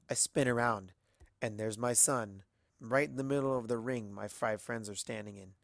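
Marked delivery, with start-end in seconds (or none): none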